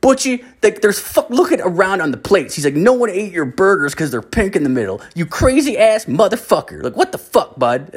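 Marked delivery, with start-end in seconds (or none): 0.0-2.5 high mock-scolding voice; exasperated relative. Relative scolds drunk uncle. 2.7-7.9 high, laughing taunt; mocking relative. Relative mocks uncle's pink burgers.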